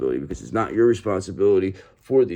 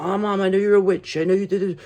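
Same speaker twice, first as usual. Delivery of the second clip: high, lightly put-on accusing voice; child telling mom she's a witch, mock voice; 9 semitones higher than usual. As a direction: play the child confronting mom, a bit hammed. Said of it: "Or you tell your mom".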